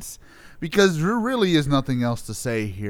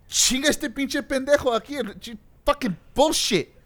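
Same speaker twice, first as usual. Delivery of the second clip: high-pitched rant in Spanish, English at the end; Spanish swearing; 10 semitones higher than usual. Pretend. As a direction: curse in Spanish, pitched well up. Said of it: "say it in a different language".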